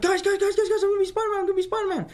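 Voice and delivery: very high, breakneck excited babble; excited shouting. Retold or imitated. Actor telling his family he got the role, excited shouting. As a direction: tumble the words out in a high squeal. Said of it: "I ran downstairs, and all my family were there".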